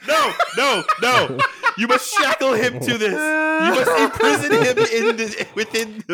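high, loud, theatrical decree; hammed-up impression. Friend hams up a grand voice to keep the bit going, mock decree. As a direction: proclaim it like a mock tyrant. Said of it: "tasteful impression".

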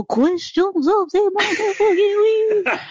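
high, slow, drawn-out silly announcement; sing-song it high and slow, half laughing; hammed-up segment intro. Host kicking off listener questions, hammed-up high drawl.

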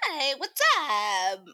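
loud slow chirpy "Hey, what's up?"; cheery greeting. Retold or imitated. Mocking her own unsexy come-on, loud and slow. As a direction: greet him brightly, not sexy at all. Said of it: "I don't know how to do the sexy voice".

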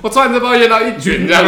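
bursting with laughter, words loud and high; laughing while talking. Real, not acted. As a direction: laugh through the words as you talk. 边笑边说放错重点. (边说边大笑)

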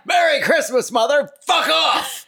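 high, loud mock screech; shrieking daughter. Daughter screaming at her mother at Christmas, high and loud.

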